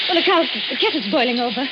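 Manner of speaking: sudden loud alarm, then settling; startled cry. Acted. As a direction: jump at the boiling kettle. Wife startled by kettle.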